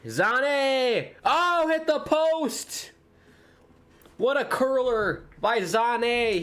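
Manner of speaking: excited commentary. Real, not acted. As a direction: ride the chance as it hits the post. Near miss in live commentary.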